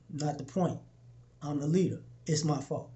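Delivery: low, soft, firm acceptance of fault; quietly shoulder the blame; leader taking blame. Retold or imitated.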